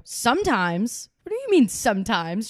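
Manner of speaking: "Sometimes" drawn out slow with a rising tease; mock-offended on "sometimes"; playful mock outrage; teasing stress. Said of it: "I was fucking with her".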